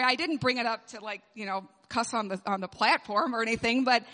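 ordinary delivery

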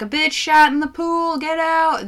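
high, loud pool guard shout; lifeguard yelling. Retold or imitated. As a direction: bark it like a lifeguard ordering everyone out. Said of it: "And they're like".

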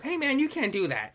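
high-pitched mimicked protest; Louis C K bit. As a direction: act out an airport employee objecting. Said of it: "like that Louis C K bit".

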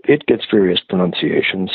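ordinary delivery

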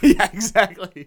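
loud laughing agreement. Real, not acted. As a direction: burst out laughing as you agree. Laughs at Nick's joke.

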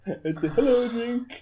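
high, slow put-on voice; goofy mock voice. Mocking the Hitler drink joke, goofy high voice. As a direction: ham it up like a dorky uncle.

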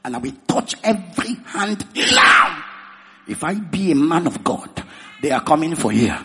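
loud fervent preacher calling to the church; preaching at full force. Acted. Pastor rousing the congregation to pray, fervent preaching. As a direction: drive the congregation into prayer.

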